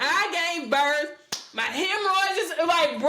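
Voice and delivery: hammed-up postpartum complaint; mock exasperated. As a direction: plead like your body is done. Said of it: "I'm like".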